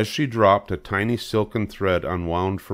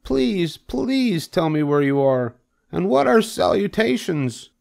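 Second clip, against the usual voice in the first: high, insistent pig plea; pleading Wilbur; 12 semitones higher than usual. Acted. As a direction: beg for an answer, high-pitched.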